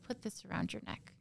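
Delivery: a hushed, menacing retold order; retold whispered order. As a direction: whisper the husband's command under your breath.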